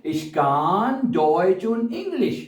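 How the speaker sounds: very slow German sentence; slow demo. Shown as a demonstration. Teacher giving the shorter version, very slowly. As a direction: lay each word out slowly. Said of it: "क्या कह सकते हैं".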